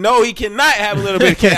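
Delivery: high, loud firm no; firm refusal. Retold or imitated. Dad overruling grandma on candy, loud and high. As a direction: put your foot down on cannot.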